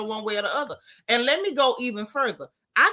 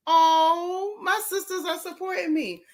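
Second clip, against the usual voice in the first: touched; 8 semitones higher, slower than usual. Real, not acted. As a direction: melt at the support. Touched by supporters.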